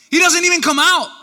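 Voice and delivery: loud, high exclamation; incredulous outburst. Real, not acted. Preacher stunned Elijah stays inside, loud high outburst. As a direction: burst out with it, high and loud all through. Preacher repeats point in exasperated amazement.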